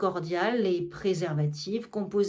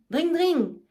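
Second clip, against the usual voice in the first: higher ringing "dring, dring" like a hand bell; bell imitation; 6 semitones higher than usual. Argan ringing for his servants, mimicking a bell. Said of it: "Il sonne une sonnette".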